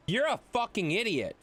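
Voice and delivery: ordinary talk, nothing marked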